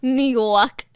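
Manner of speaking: loud mimicked New York, R dropped; mock NEW YORK as Noo Yawk, New York style; New York accent. Teasing her companion's word, New York accent. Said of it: "there you go".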